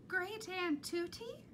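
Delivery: lightly voiced small child, high. A narrator's reading. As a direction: call out timidly. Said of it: "A small voice called out".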